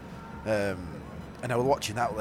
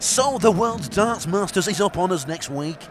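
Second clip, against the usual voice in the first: slow, louder, nasal presenter read; posh presenter voice; 4 semitones higher, 5 dB louder, slower than usual. Shown as a demonstration. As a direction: copy the polished presenter delivery. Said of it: "I'll try it".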